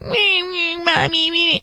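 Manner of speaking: high whimpering little boy, mock sobbing; whiny crying kid. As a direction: imitate a bratty child whining for mommy. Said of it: "And he was like".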